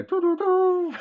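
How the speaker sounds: high sung Batmobile theme hum; playful high Batmobile fanfare. Retold or imitated. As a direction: hum the Batmobile tune as the car arrives. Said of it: "like the Batmobile".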